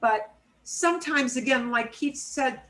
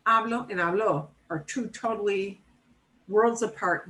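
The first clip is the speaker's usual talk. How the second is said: ordinary talk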